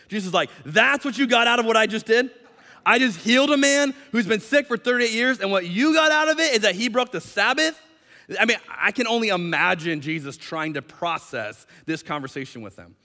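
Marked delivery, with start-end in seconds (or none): 0.6-7.8 high, louder disbelief; incredulous. Acted. Jesus answers rule-keepers who missed the healing, incredulous. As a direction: throw it back at them, astounded.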